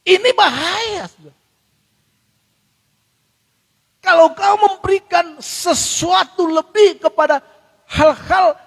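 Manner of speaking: impassioned sermon climax; fiery preaching. Acted. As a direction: thunder the warning at the congregation. Preaching at full force.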